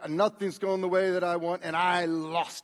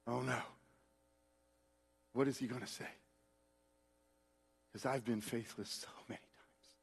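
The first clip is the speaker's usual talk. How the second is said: whispered, near silent admission; whispered confession; 6 semitones lower, 12 dB quieter than usual. Real, not acted. Preacher confesses his failures to the congregation, whispering. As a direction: breathe it out as a whisper.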